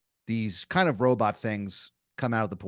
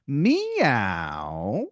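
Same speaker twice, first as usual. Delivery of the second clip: drawn-out rising meow; mock cat meow; robot cat meow; 2 semitones higher than usual. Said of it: "All of them go in unison".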